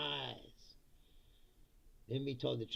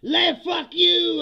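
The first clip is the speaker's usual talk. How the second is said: slow, much higher and louder defiant yell; mock French shout; 12 semitones higher, 15 dB louder, much slower than usual. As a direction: bellow it like an angry French crowd. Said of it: "And they go".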